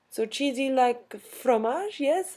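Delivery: high, slow, questioning imitation of a French student; puzzled French student. French student checking an English word, high and questioning. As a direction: check the meaning with a rising lilt. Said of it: "the French students".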